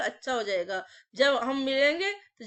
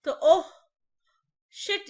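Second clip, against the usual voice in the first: mock dismay; 5 semitones higher, 4 dB louder than usual. Mock dismay in vocabulary mnemonic.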